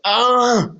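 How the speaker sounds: cry of pain. Retold or imitated. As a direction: let out a sudden pained "Ah!". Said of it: "starts crying out in pain".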